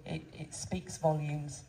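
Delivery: ordinary delivery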